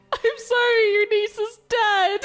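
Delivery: very high, slow fake condolence; hammed-up sorry, breaking into laughter; high mock-sorrowful Flick. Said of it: "Flick be like".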